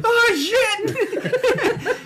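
very high yelp dissolving into laughter; startled yelp. Retold or imitated. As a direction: squeal it as you fall. Sliding down a ditch drunk.

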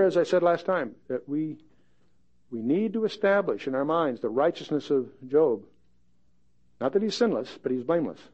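ordinary talk, nothing marked

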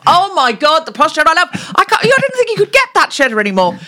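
very high, fast, thrilled squeal; overjoyed at the cheese; enthusiastic. Shown as a demonstration. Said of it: "accept each other's ideas with enthusiasm".

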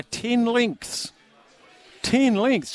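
high-pitched disbelief on each word; incredulous. Real, not acted. Host reading listener's bold Cup tip, incredulous.

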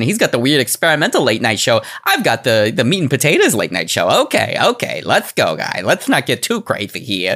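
hammed-up talk-show host with swooping pitch; cartoonish Leno, sliding toward Roger Rabbit; Jay Leno impression. Leno pitching his safe late-night show, cartoonish impression. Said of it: "That was, that was Roger Rabbit".

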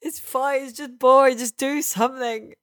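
lampooning his own griping, hammed up; exasperated hater. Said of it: "I'm always like".

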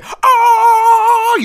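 very high, loud stretched "ah"; high rock-star wail. Retold or imitated. The rock star wailing back at the duo. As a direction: let out a piercing held cry.

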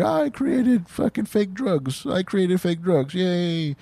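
giddy pride. Retold or imitated. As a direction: crow over it like a kid.

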